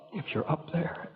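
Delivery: soft, whispered plea to God; whispered prayer. Acted. Desperate man praying alone in a bar, whispering. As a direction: pray under your breath.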